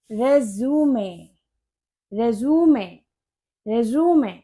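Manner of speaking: रेज्यूमे as "RE-zoo-may", three syllables; CV pronunciation. Shown as a demonstration. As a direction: model रेज्यूमे slowly with final "may". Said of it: "उसे आप बोलोगे". (रेज्यूमे, तीन हिस्से "रे-ज़ू-मे")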